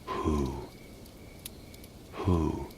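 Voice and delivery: soft, rounded owl call; owl hoot. Acted. Narrator imitates owls calling in the gathering dark, soft hooting. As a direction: hoot gently like an owl, leaving space between calls. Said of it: "Who is that hooting out there".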